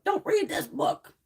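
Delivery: slow, high-pitched imitation of a parent; forbidding parent. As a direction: mimic a parent spelling out a ban. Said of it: "hear their parents going".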